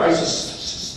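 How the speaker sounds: stuttering on the s. Shown as a demonstration. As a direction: catch on the first sound. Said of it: "stuttered a lot".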